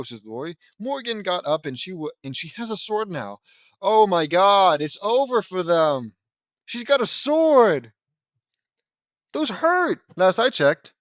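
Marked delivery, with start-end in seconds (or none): 3.8-10.9 loud, higher oh my god, slow at first; alarmed excitement. Real, not acted. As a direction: react to the sword with big mock alarm. Reacting to Morgan's sword.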